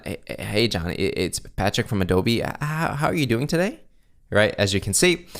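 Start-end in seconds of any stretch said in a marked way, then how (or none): 0.0-3.8 nervous tone. Shown as a demonstration. Nervous salesperson opening a cold call, stuttering nervously. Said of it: "speaking with a nervous tone".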